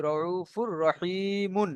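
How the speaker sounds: "রউফুর রহিমুন" slowly, sounding the final "mun"; read with harakat. Shown as a demonstration. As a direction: stretch the i of "রহিমুন" on a level tone. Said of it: "তেমন হারকাত পড়বেন".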